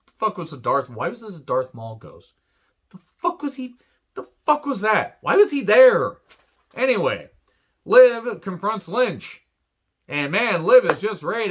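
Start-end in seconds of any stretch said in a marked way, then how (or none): 2.9-6.2 high-pitched incredulous questioning, building louder; comically bewildered, voice way up; baffled outburst. Acted. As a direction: start high and baffled, end in a loud squawk. Baffled rant about the ghost.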